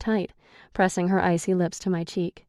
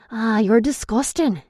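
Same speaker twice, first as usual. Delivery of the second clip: disgusted Irish man; 5 semitones higher, 4 dB louder than usual. A narrator's reading. Declan disgusted at narrator.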